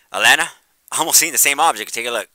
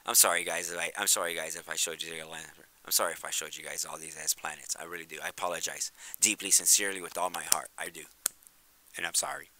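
softer, lower, heartfelt apology near tears; sad apology; 4 semitones lower, 7 dB quieter than usual. Real, not acted. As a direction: apologize sincerely, voice sinking. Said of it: "I do wanna cry right now".

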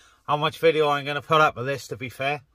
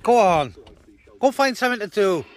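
raised voice shooing the sheep off; half-laughing shoo at sheep by the car; shooing call; 6 semitones higher, 3 dB louder than usual. Real, not acted. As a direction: call them off, higher and louder.

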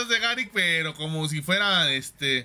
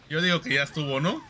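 ordinary talk, nothing marked

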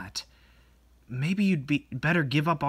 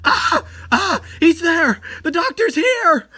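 high, loud terrified cries; panicked screams; 13 semitones higher, 7 dB louder, slower than usual. Acted. Friend sees the doctor arrive, screaming in panic. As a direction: scream that he's here.

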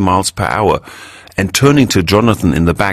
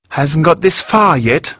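much higher, emphatic woman's voice; emphatic Elvira; 9 semitones higher than usual. A narrator's reading. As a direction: press every word with conviction. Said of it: "said Elvira emphatically".

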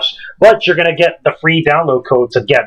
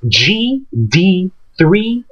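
very slow, raised pitch, character by character; slow dictation; 4 semitones higher, much slower than usual. Real, not acted. Host reading a giveaway code to listeners, slowly spelled out. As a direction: spell it out slowly, each letter set apart.

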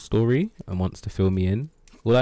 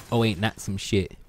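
ordinary delivery

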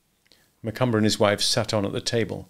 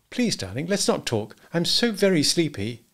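higher-pitched, coaxing wife; sleepy pleading; 8 semitones higher than usual. A narrator's reading. Wife to quarrelling husband at night, pleading.

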